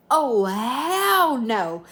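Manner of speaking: drawn out, very slow and loud hell no; loud slow refusal. Retold or imitated. To her date at the hotel, loud slow outraged refusal. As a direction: stretch every word, loud and outraged.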